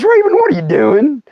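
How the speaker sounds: high, baffled friend's voice; mock incredulous buddy. Big buddy walking up to the snake fight, mock incredulous. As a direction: call out in hammed-up disbelief.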